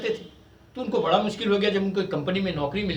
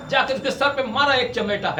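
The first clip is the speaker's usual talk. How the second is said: normal talking voice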